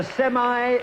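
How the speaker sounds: "semi" said as SEM-eye; American pronunciation. Real, not acted. Host catching his co-host's way of talking, "semi" as SEM-eye. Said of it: "you got me saying it now".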